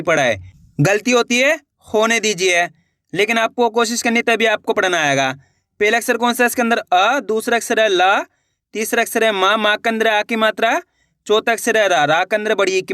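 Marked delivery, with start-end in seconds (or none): none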